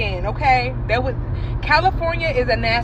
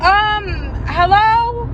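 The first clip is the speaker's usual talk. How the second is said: puzzled hello; 8 semitones higher, much slower than usual. Retold or imitated. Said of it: "And I'm like".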